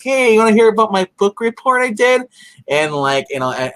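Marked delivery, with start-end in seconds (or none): 0.0-2.2 put-on much higher, louder eager-to-please kid; sweet little angel. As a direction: chirp it like a too-good little angel. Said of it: "being a sweet little angel".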